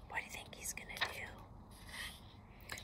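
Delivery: slow, soft whisper; whispered aside. Real, not acted. Reader asking listeners about the wolf, whispered aside. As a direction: whisper it slowly, as if letting listeners in.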